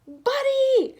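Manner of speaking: loud, high call into the distance. A narrator's reading. Boy calls his lost dog across the mountain, loud. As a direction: call the dog's name far off. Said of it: "Sam called over and over".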